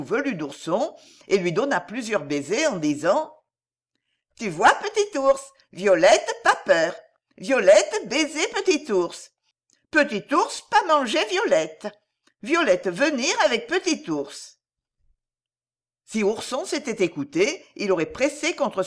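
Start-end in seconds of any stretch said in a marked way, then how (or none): 4.4-14.5 put-on high, small childlike voice, comforting; a toddler soothing a crying friend; little girl voice. Little Violette comforting the weeping bear boy, high childlike voice.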